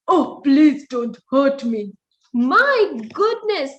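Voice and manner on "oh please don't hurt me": scared lion. Acted. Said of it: "He said to the mouse".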